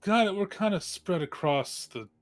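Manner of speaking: ordinary delivery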